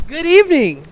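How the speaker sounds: loud bright anchor greeting; news anchor voice. Pretend. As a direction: announce it like a TV host.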